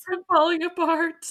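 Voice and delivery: put-on high, loud childlike wail; hammed-up little kid falling apart; mock child panic. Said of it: "She thinks she's falling apart".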